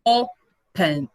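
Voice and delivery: "Open" split slowly, first syllable leading; stress on first syllable. Shown as a demonstration. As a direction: Open in two separated beats, weight on O. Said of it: "a mais forte é o O".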